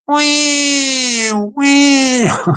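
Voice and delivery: drawn-out wailing "wah wah"; mock cat wail. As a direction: imitate a cat's shriek. Imitating cat wailing sound.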